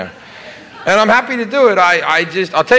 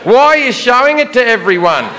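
high, polite Australian sarcasm; sweetly sarcastic Aussie guard; 6 semitones higher than usual. Retold or imitated. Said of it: "very politely but extraordinarily sarcastically".

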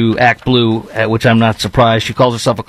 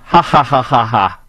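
mocking laugh. Real, not acted. Mocks woman's celebrity question.

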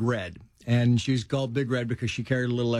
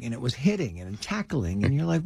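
normal talking voice

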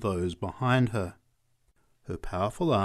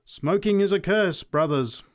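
high, loud denunciation; fervent street-preacher call; preacher's cry; 9 semitones higher, 6 dB louder than usual. A narrator's reading. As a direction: preach it out to the crowd. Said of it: "cried the preacher".

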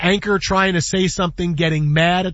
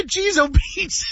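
mock exasperation; 10 semitones higher than usual. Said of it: "why the".

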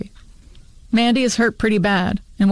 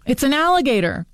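high-pitched mock scream at normal volume; restrained narrated scream, pitched up; 6 semitones higher than usual. A narrator's reading. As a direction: cry the alarm in a high voice, without real force. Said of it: "until Terry screams".

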